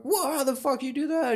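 very high, amazed cry. Retold or imitated. Retelling the rock throw with a very high amazed cry. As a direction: sound blown away by the throw. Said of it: "I'm like".

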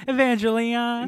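high, loud campy drag queen name. Retold or imitated. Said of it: "That sounds like a drag queen".

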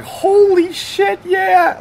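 high-pitched, drawn-out excited exclamation; thrilled coworker. Retold or imitated. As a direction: imitate an overjoyed rookie, stretched out high. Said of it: "it was a big deal".

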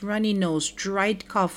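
ordinary talk

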